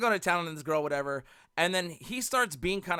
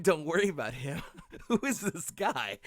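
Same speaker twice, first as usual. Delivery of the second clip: laughing disbelief. Retold or imitated. Incredulous at the old man.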